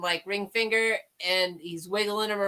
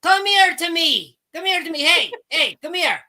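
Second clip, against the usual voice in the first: higher-pitched mock Irish catchphrase; Irish accent; 5 semitones higher, 5 dB louder than usual. Shown as a demonstration. Friend demonstrating the Irish thing, mock Irish accent. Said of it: "With the Irish thing".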